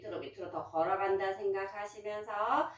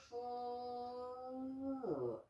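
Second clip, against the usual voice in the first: long exhale; 4 semitones higher, 9 dB quieter than usual. Shown as a demonstration. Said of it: "instructor models exhale".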